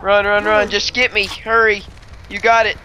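urgent, loud, rushed; fleeing and yelling to hurry; being chased. Real, not acted. Fleeing enemies, urging partner.

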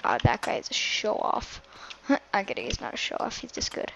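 teasing whispered aside. Real, not acted. Whispering a tease to her friend about a player. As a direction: hush it to a breathy joke.

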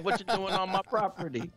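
angry farmer. Retold or imitated. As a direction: bark it like a pitchfork farmer. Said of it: "some guy with a pitchfork would have come out and said".

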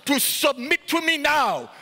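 high, forceful mock demand; mimicked commanding preacher. Preacher mimicking ministers demanding submission from others, commanding. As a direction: demand it like an overbearing leader.

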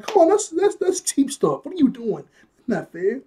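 high-pitched mock complaint, easing toward the end; yelled protest. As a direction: protest it like an exasperated onlooker. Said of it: "he's yelling again".

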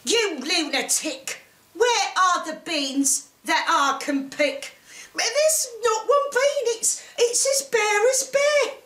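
exasperated scolding, climbing high in pitch; scolding mother. A narrator's reading. Mother to Jack about the missing beans, scolding. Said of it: "The mother said".

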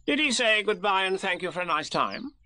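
pressing question in a high character voice; officious Rabbit. Acted. Rabbit questions Christopher Robin officiously.

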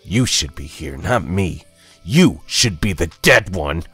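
angry accusation. A narrator's reading. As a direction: hurl the blame at your brother. Dead brother blames Jonah, building.

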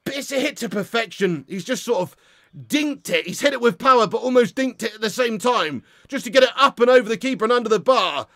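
normal talking voice

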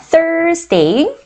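very slow Thursday, th clearly breathed. Shown as a demonstration. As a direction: drill Thursday slowly with th. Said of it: "발음 교정해 드릴게요". (천천히 th를 살려 Thursday)

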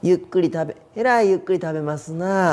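high, politely barbed Kyoto lilt; mean the opposite in sweet Kyoto tones; snide Kyoto dialect. Acted. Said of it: "その逆のことを言うんでしょ？".

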